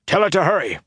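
high-pitched urgent call; urgent shout. A narrator's reading. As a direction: call out to hurry her.

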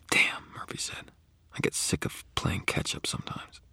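hard-edged whisper; quiet, steel hard. Acted. Murphy whispering her frustration to Harry. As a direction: whisper it with tight resolve.

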